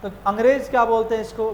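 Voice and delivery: ordinary delivery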